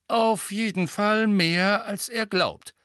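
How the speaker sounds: high, slow, measured figure voice; lightly characterised Lupin. A narrator's reading. Lupin adding to what Sirius said, slow and high.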